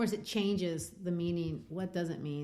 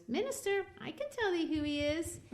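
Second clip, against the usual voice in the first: put-on much higher child's voice; little girl voice; 11 semitones higher than usual. Pearl teasing the minister, high little girl voice. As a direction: play a small knowing girl. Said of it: "Pearl goes".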